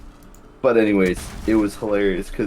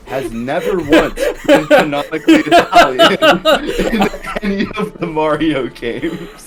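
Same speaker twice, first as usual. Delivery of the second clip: much higher, louder, laughing; squealing with laughter while quoting; laughing through quote; 12 semitones higher, 9 dB louder than usual. Retold or imitated. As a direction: crack up mid-quote. Quoting video guy, cracking up.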